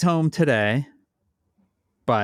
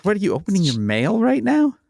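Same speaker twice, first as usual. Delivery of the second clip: surprised; 10 semitones higher, 5 dB louder than usual. Real, not acted. Cohost suddenly busy mid-show.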